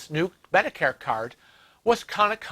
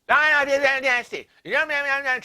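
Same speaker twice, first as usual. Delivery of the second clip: high, mocking whiny agent voice; mock the nasty clerk's defensive whine; a hint of a snippy clerk insisting she's not nasty; imitating the rude agent; 9 semitones higher than usual. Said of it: "she's yelling at me".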